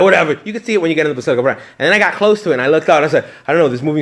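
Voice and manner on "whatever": dismissive. Retold or imitated. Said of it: "I was like".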